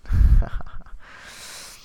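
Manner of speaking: breathy burst of laughter; loud laugh. Real, not acted. Astrologer laughing at the afflicted Venus joke, loud laugh. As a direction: laughs at her own punchline.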